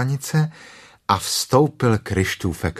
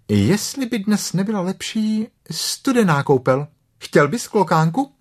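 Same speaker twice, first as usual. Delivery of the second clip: higher, musing mother voice; thoughtful Kanga; 10 semitones higher than usual. Acted. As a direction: wonder aloud like a playful mum. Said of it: "řekla klokanice zamyšleně". (zamyšleně, vyšším hlasem)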